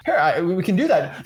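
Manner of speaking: usual speaking voice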